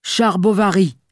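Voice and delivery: put-on shy boy suddenly bellowing his name; blurted at full lungs. A narrator's reading. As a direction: fling the name out as if calling someone. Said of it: "lança à plein poumon".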